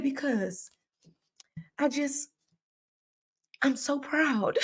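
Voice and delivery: high, soft, halting imitation of crying; mock tearful. Wife imitating her husband crying with pride, mock tearful. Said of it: "He started crying the other day".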